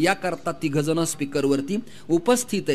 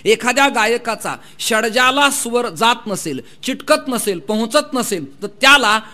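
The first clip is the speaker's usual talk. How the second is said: raised-pitch oratory building to a loud peak; impassioned preaching; 4 semitones higher, 6 dB louder than usual. Real, not acted. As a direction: build the argument into a ringing cry. Preaches, rising to full force. (चढत जाणारं जोरदार प्रवचन)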